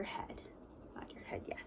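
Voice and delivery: sneaky hushed whisper. Shown as a demonstration. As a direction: whisper it secretly to the kids. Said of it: "If you're sneaky and you know it".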